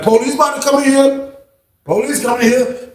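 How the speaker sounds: high urgent alert; alarmed warning. Retold or imitated. Inmate warning the pod about police, high urgent. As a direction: warn everyone the cops are coming, high.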